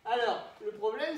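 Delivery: ordinary talk, nothing marked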